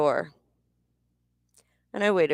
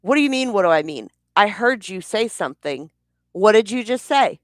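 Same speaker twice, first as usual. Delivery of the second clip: higher and louder, furious demand; angry shouting; 4 semitones higher, 5 dB louder than usual. Retold or imitated. Retelling how she yelled at her husband, furious shout. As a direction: bark the questions at him, outraged.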